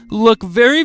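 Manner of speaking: plain reading voice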